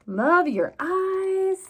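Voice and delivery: slow, loud, sing-song line; read to children. A narrator's reading. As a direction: read it big for little listeners. Expressive read-aloud.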